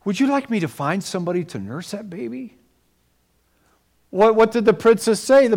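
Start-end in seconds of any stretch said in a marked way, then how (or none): 0.0-2.6 soft polite offer. Retold or imitated. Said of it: "She had to act like".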